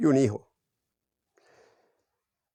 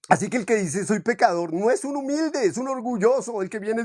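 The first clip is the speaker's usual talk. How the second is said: high preaching; 6 semitones higher than usual. Real, not acted. Preacher rebukes false humility.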